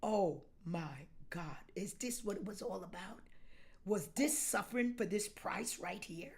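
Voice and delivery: low, soft, whispering in awe; awed whisper. Pretend. Pastor acting a numbed believer whispering in awe. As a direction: breathe it out, stunned. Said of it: "You be like".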